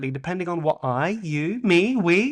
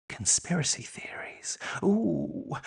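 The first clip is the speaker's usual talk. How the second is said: breathy whisper, voice dropped away; conspiratorial whisper; 8 dB quieter, slower than usual. Pretend. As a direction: whisper it like a dark secret. Whispered teaser.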